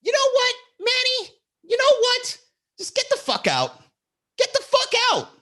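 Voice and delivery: very high, hammed-up angry yell; mock screechy tirade; imitated yelling tirade. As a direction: act out her kicking you out, shrieky and high. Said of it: "yelling tirade".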